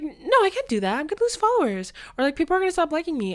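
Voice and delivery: high, loud, rushed whiny panic; panicked protest. Retold or imitated. Teen protesting to auntie, high panicked rush. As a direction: gabble the fear of losing followers.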